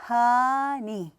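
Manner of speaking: honey with a long loud first syllable and a falling tune; honey, HO long, ney short; stress on first syllable. Shown as a demonstration. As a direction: lean on HO in honey. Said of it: "강하고 길게".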